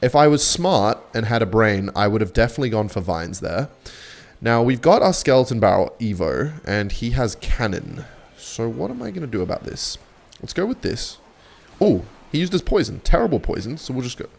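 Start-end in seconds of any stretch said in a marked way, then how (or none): none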